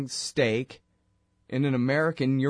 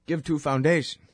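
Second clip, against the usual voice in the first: mock Russian accent, no article before "foundation"; Russian accent with "Give to a foundation" clipped; pretend Russian accent; 3 semitones higher than usual. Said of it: "They don't have articles".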